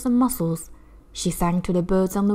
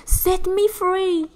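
much higher, slow pleading; begging; 11 semitones higher, much slower than usual. Acted. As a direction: plead for freedom, stretched out. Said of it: "she begged him".

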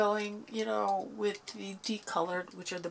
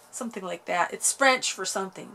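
ordinary talk

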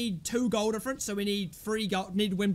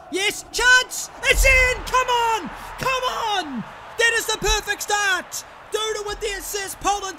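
high, loud ecstatic yelling; goal celebration; 9 semitones higher, 5 dB louder than usual. Real, not acted. Player roaring as Poland score, ecstatic shout. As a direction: erupt with joy at the goal.

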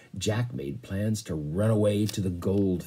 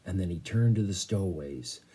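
usual speaking voice

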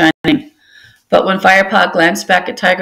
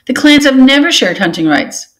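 high, loud protest; shocked cry; 8 semitones higher, 3 dB louder than usual. A narrator's reading. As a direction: insist it in alarm. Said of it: "cried a tortoise queen".

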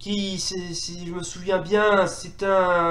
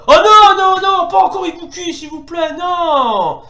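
loud, high drawn-out pleading; high begging cry of dismay; dismayed wail; 10 semitones higher, 8 dB louder than usual. Real, not acted. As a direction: wail in protest at a dreaded opponent. Dreads next opponent Ibuki.

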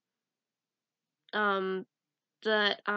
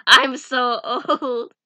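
slow, louder mock lament; dramatic lament; 9 dB louder, much slower than usual. Real, not acted. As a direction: wail it dramatically. Joking about age.

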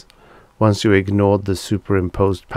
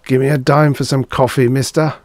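higher, wheedling appeal; lightly voiced panhandler; begging stranger; 5 semitones higher, 4 dB louder than usual. A narrator's reading. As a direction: ask for a handout, pitched up. Beggar voiced in narration.

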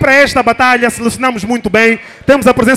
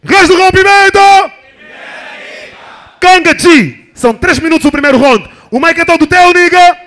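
booming hype call, much higher and louder; fighting game announcer; 7 semitones higher, 5 dB louder than usual. Acted. Host hyping battle start.

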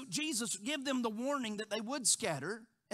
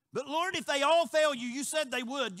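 loud, high self-assured vow; boastful Peter; 6 semitones higher, 8 dB louder than usual. Retold or imitated. Peter vowing to the Lord, loud and proud. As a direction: swear loyalty, full of himself. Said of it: "he says".

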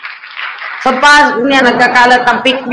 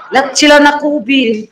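usual speaking voice

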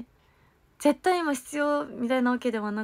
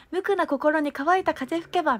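slower, higher, every mora spaced out for the group; group echo of drill; 5 semitones higher, 4 dB louder than usual. Shown as a demonstration. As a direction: lead the group through it, carefully articulated. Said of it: "その後みんなで続いて".

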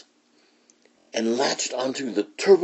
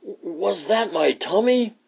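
much higher, slow alarmed question; startled question; 11 semitones higher, slower than usual. Acted. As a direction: ask it half scared. Scipito startled by growl.

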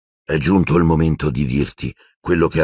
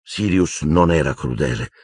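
ordinary delivery, nothing marked

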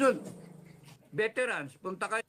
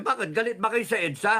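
ordinary talk

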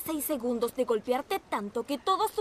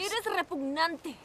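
disgusted; 5 semitones higher, much slower than usual. Acted. Disgusted at Evan's offer.

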